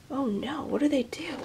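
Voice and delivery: soft hushed oh no; soft, hushed aside; whispered worry. Real, not acted. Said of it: "aside to listener".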